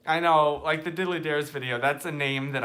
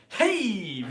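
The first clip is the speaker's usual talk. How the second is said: high, loud, stretched "hey"; Michael impression; 6 semitones higher, 6 dB louder than usual. Host retrying his impression, loud imitated greeting. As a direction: mimic a punched show-opening greeting. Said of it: "That was my Michael impression".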